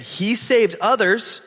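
mock him from below the cross; scoffing. A narrator's reading. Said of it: "the ruler scoffed at him".

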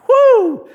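high, loud "whoo" of relief; exaggerated high sigh of relief; relieved whoop. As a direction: let out a big acted phew. Relieved dad's exhalation.

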